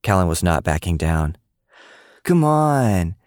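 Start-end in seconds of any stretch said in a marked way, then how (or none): 2.2-3.2 high, whiny nag; a fed-up girl nagging her friends, high and whiny. A narrator's reading. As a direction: drag her friends away, fed up and much higher. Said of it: "Emmy nagged".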